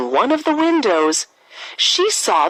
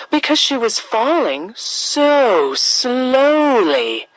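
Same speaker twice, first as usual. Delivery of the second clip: ordinary delivery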